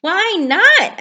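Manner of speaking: puzzled Frog; curious Frog. Acted. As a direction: ask puzzled. Frog asking.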